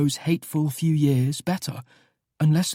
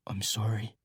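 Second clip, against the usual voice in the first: hushed and soft, barely voiced; late-night whispered sorry; whispered apology; 7 semitones lower, 10 dB quieter than usual. A narrator's reading. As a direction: breathe it out so no one else wakes. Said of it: "I hear Nate whisper".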